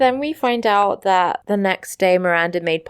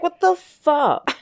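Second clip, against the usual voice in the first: higher-pitched, drawn-out mock exasperation; exasperated retold outburst; 9 semitones higher, slower than usual. Miranda fed up with the whining puppy, mock exasperation. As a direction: draw it out in imitated disbelief. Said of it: "Miranda's like".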